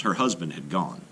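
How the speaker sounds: ordinary delivery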